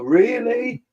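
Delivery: high, drawn-out incredulous "Really?"; aghast, pitching it way up. Retold or imitated. Dog owner finding a mess on the landing, high incredulous. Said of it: "and I'm like".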